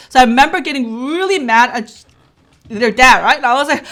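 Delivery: ordinary delivery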